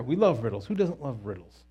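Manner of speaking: ordinary talk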